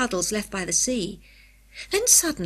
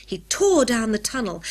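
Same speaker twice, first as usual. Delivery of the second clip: ordinary delivery, nothing marked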